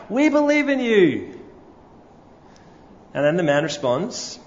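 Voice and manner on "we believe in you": high, united crowd shout; crowd yelling back. A narrator's reading. Crowd shouting back to the tightrope walker, high and loud. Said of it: "once more the shout comes back".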